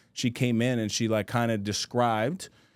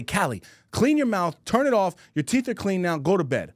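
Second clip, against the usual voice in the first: raised, higher-pitched exasperated parent; frustrated dad; 8 semitones higher than usual. Retold or imitated. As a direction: rattle off orders like a fed-up dad, acted. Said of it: "I'm already frustrated".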